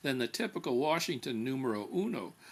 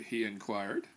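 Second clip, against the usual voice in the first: ordinary delivery, nothing marked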